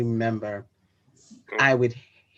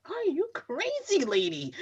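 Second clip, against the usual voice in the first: much higher, slow mock mom asking "are you crazy"; incredulous mother; 5 dB quieter, slower than usual. Daughter voicing her mom's look, high slow disbelief. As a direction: play the stunned mother, drawn out and high.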